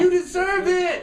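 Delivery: high, rousing insistence; emphatic affirmation. Real, not acted. Preacher tells the room they deserve it, emphatic affirmation. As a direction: pitch it way up to rally them.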